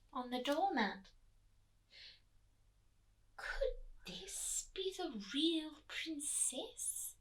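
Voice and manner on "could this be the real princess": slow hushed whisper; whispered wonder. A narrator's reading. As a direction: breathe it as a secret hope. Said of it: "said the Queen".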